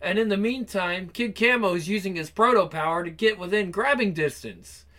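ordinary talk

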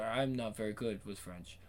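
usual speaking voice

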